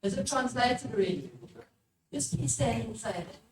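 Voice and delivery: ordinary delivery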